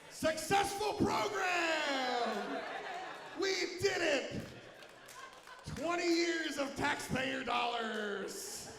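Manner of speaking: loud, slow mock-triumphant shout; sarcastic cheer. Comic mocking the DARE program to the crowd, loud sarcastic cheer. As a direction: cheer it with heavy irony.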